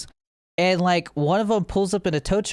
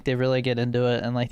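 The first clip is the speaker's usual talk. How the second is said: ordinary delivery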